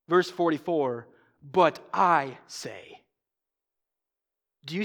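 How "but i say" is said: slow, lower, I held long; measured, deliberate stress on I; emphatic I. Retold or imitated. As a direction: draw out I with gravity. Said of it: "The I is emphatic".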